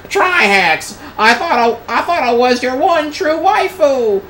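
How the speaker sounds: put-on very high, loud wail in a poor Australian accent; bad Australian accent. Worcester bursting in on Trihex as jilted waifu, shrill bad Australian accent. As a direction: shriek it in a badly faked Australian accent. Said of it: "I can't do an Australian accent".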